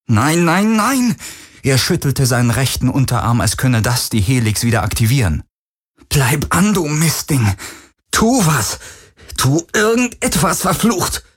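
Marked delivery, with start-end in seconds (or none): 0.1-1.1 rising, panicked no; desperate denial. Acted. Galen pleads with his fading arm in panic. The glow fades, he despairs. 6.1-11.2 high, frantic scolding; frantic cursing. Acted. Galen curses the dead device, frantic and high.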